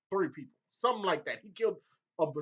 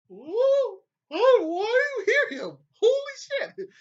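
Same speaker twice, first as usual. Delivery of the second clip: much higher, louder stunned yell; shocked outburst; 9 dB louder than usual. Retold or imitated. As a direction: blurt it out in total shock. Shocked reaction to Bran's call.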